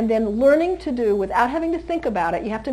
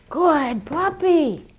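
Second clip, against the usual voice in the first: slow praise; 4 semitones higher, much slower than usual. Real, not acted. Praising puppy.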